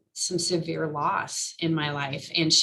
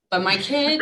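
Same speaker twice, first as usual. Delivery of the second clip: laughing; 7 semitones higher, 5 dB louder than usual. Real, not acted. Laughs while naming her kid.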